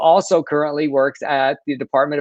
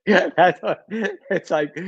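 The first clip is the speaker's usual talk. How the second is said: talking through laughter; laughing; 4 semitones higher, much slower than usual. Real, not acted. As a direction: get the words out between laughs. Amused reply to co-host's joke.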